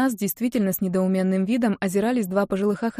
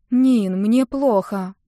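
usual speaking voice

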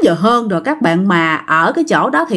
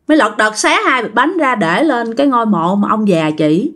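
plain reading voice

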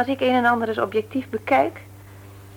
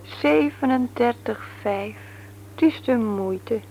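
1 slow teasing reading; 3 dB quieter, slower than usual. Acted.

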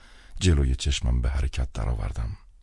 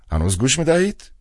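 higher, louder rising question; the old director prodding down the line; old man's voice; 10 semitones higher, 4 dB louder than usual. A narrator's reading. Kostert checks on phone line.

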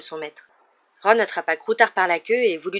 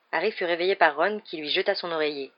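ordinary delivery

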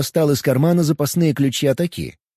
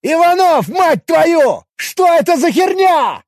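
loud, high, slow threatening yell; furious shout; 6 dB louder, slower than usual. Acted. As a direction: bellow it at him in fury. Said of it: "грозно воскликнул".

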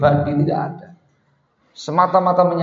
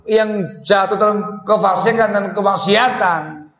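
preaching at full force; 7 semitones higher than usual. Acted. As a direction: preach it hard against going too far. Preacher rebukes excess leniency. (khotbah berapi-api, tinggi dan keras)

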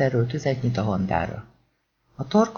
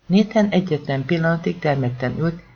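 usual speaking voice

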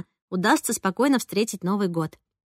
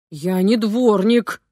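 angry retort; 3 dB louder, much slower than usual. A narrator's reading. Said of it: "рассердился папа".

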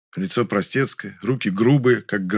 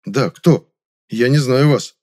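louder, firm insistence; stern voice; 3 semitones higher, 7 dB louder, slower than usual. A narrator's reading. Narrator tells the stranger he doesn't know her, stern. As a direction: repeat it strictly and emphatically. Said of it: "повторил я строгим голосом".

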